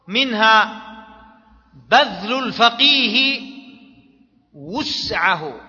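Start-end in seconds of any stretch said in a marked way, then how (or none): 4.5-5.6 وسعه said "wus'ahu", with u after the waw; damma on the waw. Shown as a demonstration. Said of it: "بالضم".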